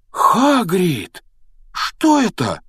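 high, astonished cry; startled exclamation. A narrator's reading. Harry spots something in the fire, astonished cry. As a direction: cry out in alarm, pitch leaping up. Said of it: "воскликнул он".

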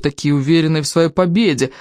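ordinary delivery

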